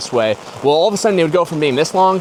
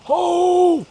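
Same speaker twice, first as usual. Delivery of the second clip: sudden realization. Real, not acted. Sudden remembering mid-story.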